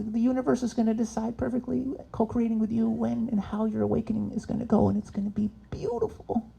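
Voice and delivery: soft, high new-age lilt; airy spiritual voice. Acted. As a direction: float it like a gentle guru.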